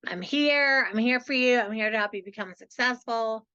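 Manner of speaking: ordinary talk, nothing marked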